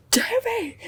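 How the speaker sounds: high, thrilled squeal of the name; excited shout. Retold or imitated. She retells spotting Tubi in class, shrieking excitedly. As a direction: shriek it like you just spotted a friend. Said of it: "I was like".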